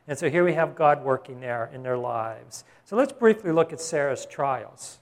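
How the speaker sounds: ordinary talk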